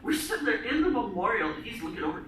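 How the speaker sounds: normal talking voice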